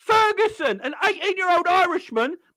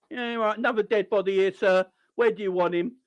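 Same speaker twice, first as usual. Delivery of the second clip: mock groundsman; 10 semitones lower than usual. Groundsman voiced, digging a grave.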